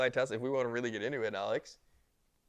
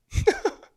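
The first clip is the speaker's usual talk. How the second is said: high laugh; 8 dB louder than usual. Real, not acted. Burst of laughter.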